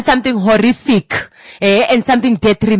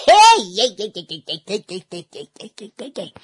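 laughing. Real, not acted. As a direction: laugh through the heys. Laughing hey chant after laugh.

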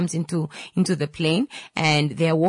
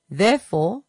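normal talking voice

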